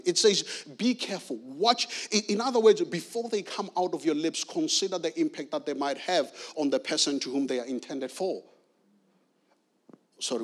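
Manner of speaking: normal talking voice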